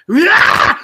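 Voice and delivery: loud high laugh breaking in; laughing outburst. Real, not acted. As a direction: burst out laughing.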